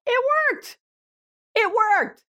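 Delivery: very high, squealed disbelief; amazed exclamation. Acted. Mom stunned the therapist's simple fix worked, high amazed exclamation. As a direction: cry it out in high-pitched amazement.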